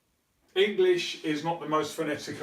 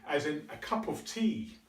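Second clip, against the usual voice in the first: ordinary delivery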